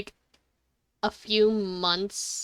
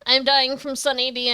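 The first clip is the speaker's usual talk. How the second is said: mock dramatic lament; 4 semitones higher, 8 dB louder than usual. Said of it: "I was gonna go".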